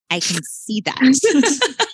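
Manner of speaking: put-on a stubborn four-year-old dragging it out; whiny little kid. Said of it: "And he goes".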